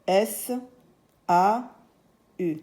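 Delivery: S, A, U said slowly with pauses; teacher spelling saute for the class; slow letter-by-letter spelling. Shown as a demonstration. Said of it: "il saute avec S".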